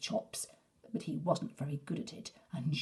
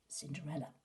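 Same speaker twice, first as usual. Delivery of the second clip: ordinary delivery, nothing marked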